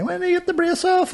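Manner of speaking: very high mimicking voice; imitated questioner. Someone asking about the brace, high mimicked voice. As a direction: put the question in a high, mocking pitch. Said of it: "somebody said to me today".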